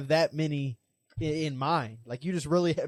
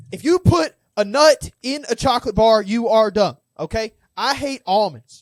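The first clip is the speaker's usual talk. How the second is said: loud, high, emphatic hot take; fueled-up rant; 5 semitones higher, 10 dB louder than usual. Real, not acted. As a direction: lay down the law, punching each word loudly. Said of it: "now I'm getting fueled up".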